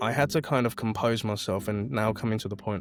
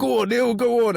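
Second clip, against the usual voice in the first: much higher, louder, rowdy egging on; drunk mates cheering; 12 semitones higher, 6 dB louder than usual. Retold or imitated. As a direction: cheer him on like tipsy mates.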